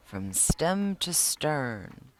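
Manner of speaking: slowly savoring each word; slow repeated phrase. Retold or imitated. Sean repeating his grandfather's saying, slowly. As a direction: draw out stem to stern. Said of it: "I kept repeating that".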